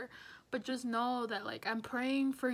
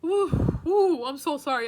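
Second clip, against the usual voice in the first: loud, high exhales, then an apology; real attempt to regain composure; composing herself; 4 semitones higher, 12 dB louder, slower than usual. Real, not acted. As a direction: blow out hard to stop the tears. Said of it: "I need to get myself together".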